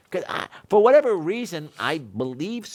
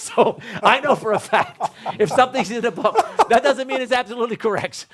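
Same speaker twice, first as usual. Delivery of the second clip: higher and louder through laughter; laughing; 7 semitones higher, 5 dB louder than usual. Real, not acted. To his wife, explaining while laughing.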